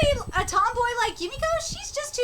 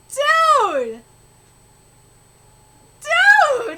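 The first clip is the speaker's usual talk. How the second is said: loud, drawn-out cry of amazement; awed, raised-voice reaction; amazed exclamation; 5 semitones higher, 8 dB louder than usual. Real, not acted. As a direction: call it out, impressed.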